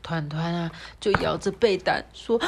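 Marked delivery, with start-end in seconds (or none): none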